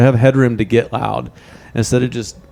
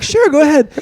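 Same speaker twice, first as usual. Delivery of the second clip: very high, put-on agreeable voice; squeaky-high, obliging imitation; mock innocent reply; 4 dB louder than usual. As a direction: play the unsuspecting good sport. Acts out the unsuspecting victim's reply.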